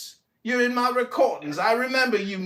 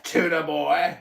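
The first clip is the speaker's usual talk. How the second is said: ordinary talk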